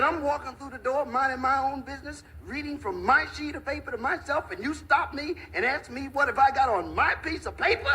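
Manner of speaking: high, indignant protest. Acted. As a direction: squeak it out, offended. Little fellow indignantly protests.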